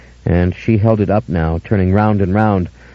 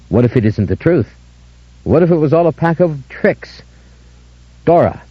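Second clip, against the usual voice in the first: higher, desperate calling out; urgent calling; 6 semitones higher than usual. A narrator's reading. Narrator calling after Dora desperately. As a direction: plead and call after her.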